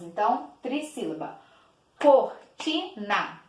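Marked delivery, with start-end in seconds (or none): 2.0-3.4 "Cortina" slowly split, COR, TI, NA; three separated beats in "Cortina"; slow, pause between the syllables of "Cortina". Shown as a demonstration. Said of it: "Três vezes".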